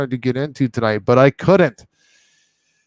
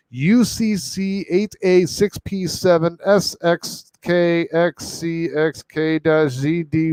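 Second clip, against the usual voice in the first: slow spelling; 4 semitones higher, slower than usual. Acted. Reading long URL aloud.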